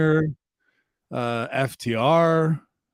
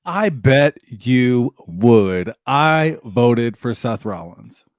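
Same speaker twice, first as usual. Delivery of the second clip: very slow, weighty delivery; playful, ponderous vote reveal; mock-dramatic reply; 2 semitones lower, 4 dB louder, much slower than usual. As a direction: draw out each word for comic suspense. Playfully answers the announcer's question.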